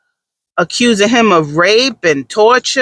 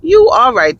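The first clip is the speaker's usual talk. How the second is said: higher, pitch jumping up on "you right"; suddenly conceding with a touch of mock surprise; agreeing with husband, lightly hammed-up realisation; 6 semitones higher than usual. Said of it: "I said".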